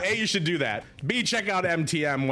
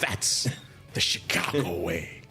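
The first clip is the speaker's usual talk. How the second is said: put-on low, slow movie-cop Scottish accent; 9 semitones lower, slower than usual. Quoting The Untouchables to co-host, mock Scottish accent. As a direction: imitate a Scottish-accented film cop, pausing after THAT'S. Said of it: "he says it in his perfect Scottish accent".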